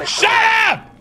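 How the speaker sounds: high, sharp furious yell; angry yell. Real, not acted. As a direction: snap it at the screen. Snapping at the game.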